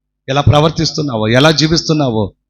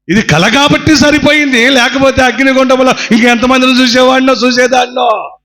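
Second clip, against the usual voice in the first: emphatic repeat; 8 semitones higher than usual. Shown as a demonstration. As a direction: repeat the line with full preaching force.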